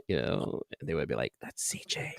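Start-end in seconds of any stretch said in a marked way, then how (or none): 1.4-2.1 awed stage whisper; hushed whisper. Acted. Onlookers spotting a surprise guest, hushed whisper. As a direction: whisper it like a bystander. Imagined people murmuring who he is.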